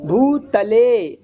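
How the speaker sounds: भूतले spoken very slowly, dental त clearly; dental ta pronunciation. Shown as a demonstration. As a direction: model भूतले syllable by syllable with a dental त. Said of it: "दंती से दांत को जीभ में लगा के बोलो". (भूतले, दंत्य त के साथ बहुत धीरे)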